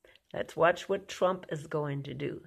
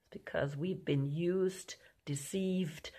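breathy whisper, much softer; hushed whisper; 8 dB quieter, slower than usual. Real, not acted. Preacher listing the country's sins to viewers, in a whisper. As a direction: drop to a whisper for the grim words. Dramatic hushed list of wrongs.

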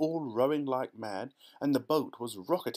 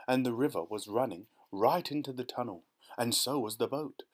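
ordinary delivery

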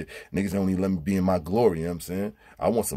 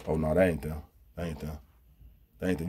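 disappointed mutter; 5 semitones lower, 6 dB quieter than usual. Pretend. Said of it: "So you be like this".

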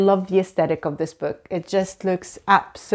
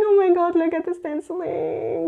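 high, thrilled oh my God; real excitement; excited gush; 9 semitones higher than usual. Real, not acted. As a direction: squeal over the details. Excited discovery.

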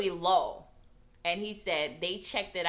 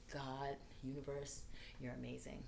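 grateful hush; 5 semitones lower, 13 dB quieter than usual. Retold or imitated. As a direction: breathe it out as awed gratitude. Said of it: "I was like".

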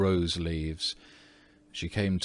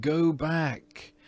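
much higher little voices crying out; tiny huntsmen warning Virginia, higher and crying out; 8 semitones higher than usual. A narrator's reading. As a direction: call her back like tiny tapestry figures. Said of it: "they cried".

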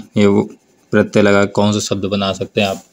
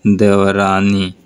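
slow demo word; much slower than usual. Shown as a demonstration. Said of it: "देवर की".

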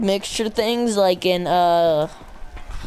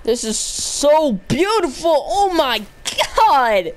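high, drawn-out gamer excitement; awestruck gushing; 6 semitones higher, slower than usual. Real, not acted. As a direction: gush in amazement, pitch swooping up high. Gushing over a new gun's look.

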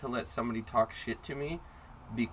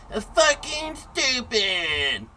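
long drawn-out high bellow; imitated kid's loud holler; super loud yell; 11 semitones higher, 5 dB louder, much slower than usual. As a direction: bellow it like a mouthy kid across the park. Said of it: "I hear a super loud".

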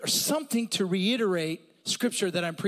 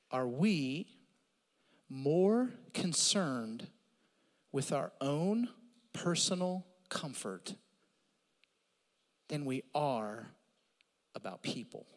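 soft, slow searching question; 6 semitones lower, 6 dB quieter, slower than usual. Real, not acted. Pointed sermon question.

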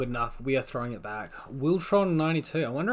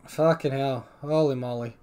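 normal talking voice

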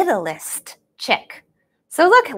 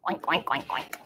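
pig oinks; 9 semitones lower, 12 dB quieter than usual. Acted. Pig sound for kids.

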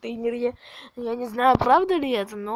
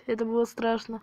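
normal talking voice

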